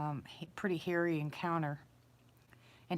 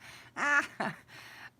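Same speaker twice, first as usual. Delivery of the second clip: high-pitched burst of laughter; helpless laugh at a funny memory; real amused laugh; 10 semitones higher than usual. Real, not acted. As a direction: laugh out high, tickled by the story. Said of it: "it was hysterical".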